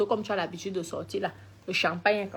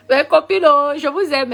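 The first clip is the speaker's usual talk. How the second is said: laughing affection; 6 semitones higher, 9 dB louder than usual. Real, not acted. Gushing to her fans.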